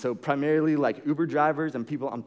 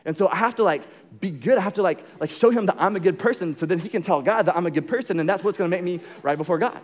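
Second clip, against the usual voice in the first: anxious inner voice; 4 semitones higher, 6 dB louder than usual. Retold or imitated. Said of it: "they think".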